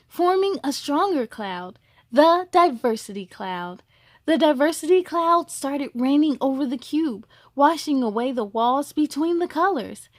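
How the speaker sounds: gentle storybook reading; storyteller voice for children; story narration. A narrator's reading. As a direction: read it like a bedtime story.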